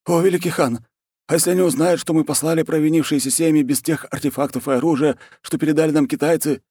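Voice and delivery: high-pitched servile military underling; grovelling war chief. Acted. War chief reports defeat to the khan, high grovelling voice.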